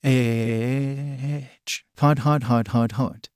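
yelping hot in pain, voice put on. A narrator's reading. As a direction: hop about yelping from a burn. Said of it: "he screamed as he ran in circles".

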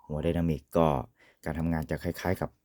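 usual speaking voice

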